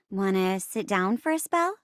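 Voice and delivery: ordinary talk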